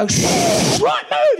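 high, loud shouted order; mock shout. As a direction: bark the getaway order.

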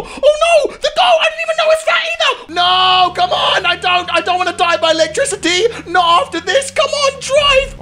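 high, desperate pleading; panicked whining yell. Real, not acted. Player fleeing the barrier, desperate high-pitched pleading.

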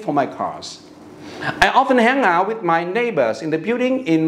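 slow, careful English model reading; story read-aloud. Shown as a demonstration. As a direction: read the story clearly for learners. Reads the English practice story aloud.

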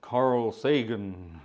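slow, low, drawn-out impersonation; Carl Sagan. Acted. Host slipping into a Carl Sagan impression for viewers. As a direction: stretch the name out in an imitated lecturer's voice. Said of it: "that was Carl Sagan, wasn't it".

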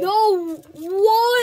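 loud, high disbelieving cry; stunned surprise. Real, not acted. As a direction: react in total disbelief. Reacts to expensive card.

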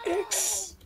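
high, soft strained squeak; weak, high-pitched croak of the letter; dying-sounding. Pretend. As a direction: squeeze it out like you're dying. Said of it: "Why do you sound like you're dying".

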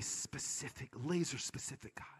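whispered softly for emphasis. Acted. Preacher to his congregation about a specific God, whispered. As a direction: lean in and whisper the point.

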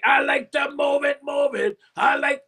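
a hint of a deep Cookie Monster growl. Shown as a demonstration. Producer asks the rapper for his Cookie Monster voice, lightly done. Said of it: "that deep Cookie Monster voice you got".

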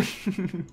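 soft little chuckle. Real, not acted. Gamer giving in to building it, soft chuckle. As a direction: chuckle quietly to yourself.

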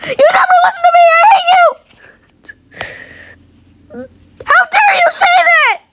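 a tantrum shouted up high; angry Shrimpo. Acted. Shrimpo yelling at a friend who never listens.

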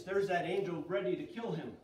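normal talking voice

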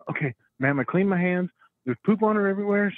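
mock alarm over a messy diaper; mock distress. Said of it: "I'm still going along with it".

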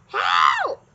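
loud, desperate shout; witch's cry for help. A narrator's reading. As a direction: yell for rescue as the hunted witch. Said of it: "cried the witch".

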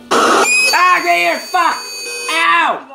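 sudden yelp of pain; pained shout. Real, not acted. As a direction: cry out in real pain. Real pain outburst while watching.

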